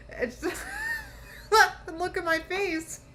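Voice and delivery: breaking into high, squealing laughter mid-words; real laughter. Real, not acted. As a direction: laugh helplessly through the words. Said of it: "I'm laughing right now".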